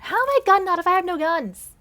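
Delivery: loud, high-pitched protest; indignant outburst. Real, not acted. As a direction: burst out at the absurd accusation. Indignant at the story's nonsense.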